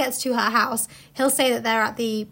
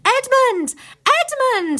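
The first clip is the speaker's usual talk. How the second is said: high loud calls into the snow; calling out; 12 semitones higher, 7 dB louder, much slower than usual. Acted. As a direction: shout the name across the distance. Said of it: "they called till they were hoarse".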